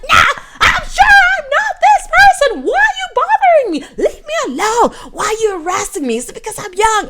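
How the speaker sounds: loud, high-pitched furious outburst; flipping out on someone. Retold or imitated. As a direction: lash out at a pestering stranger, shouting at the top of your range. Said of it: "I just flipped on the guy".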